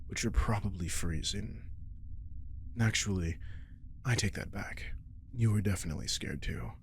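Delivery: ordinary talk, nothing marked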